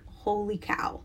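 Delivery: whispered in mock awe. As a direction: breathe it like a stunned aside. Mom's mocking reaction.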